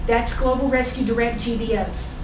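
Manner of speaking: normal talking voice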